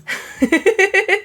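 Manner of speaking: real laugh at a dumb joke; bursting out laughing high; high burst of laughter. Real, not acted. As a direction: genuine high-pitched giggle.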